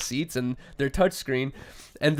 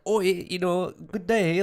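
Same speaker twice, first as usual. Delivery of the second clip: imitating a British accent, pitched higher; 4 semitones higher, slower than usual. Imitating Londoners greeting on the street, a British accent. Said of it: "everyone's just like".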